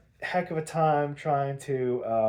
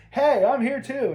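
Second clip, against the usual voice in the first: higher, louder eager Robin; 9 semitones higher, 6 dB louder than usual. Retold or imitated. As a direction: pipe up like the sidekick arriving. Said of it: "and Robin's like".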